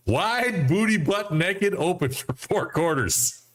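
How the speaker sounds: high, slow, drawn-out relish; stretched-out comic emphasis; gleeful quoting. Retold or imitated. Relishes a funny quoted post.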